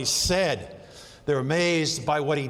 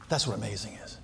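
low, soft, settled statement; hushed; 10 semitones lower, 8 dB quieter, faster than usual. Real, not acted. Preacher quietly summing up what amazing means to the congregation. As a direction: let it land low and quiet. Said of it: "repeated quieter".

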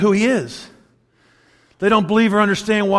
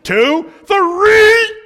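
loud rising count, three pitched much higher; the count climbs higher and louder to a yell on three; 9 dB louder than usual. Retold or imitated. Parent finishing the countdown at a stubborn child, much higher and loud.

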